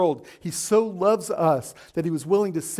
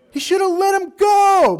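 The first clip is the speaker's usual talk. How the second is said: preacher's shout; 11 semitones higher, 6 dB louder than usual. Real, not acted. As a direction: shout the answer to the whole congregation. Preacher shouts answer to congregation.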